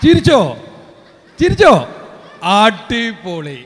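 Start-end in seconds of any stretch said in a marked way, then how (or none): 0.0-1.7 high, loud cheer urging the crowd to laugh; excited call. Real, not acted. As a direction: shout it up at the crowd, egging them on. (ആവേശത്തോടെയുള്ള വിളി)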